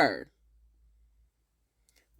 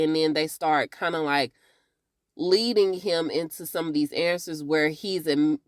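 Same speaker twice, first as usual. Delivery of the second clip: ordinary talk, nothing marked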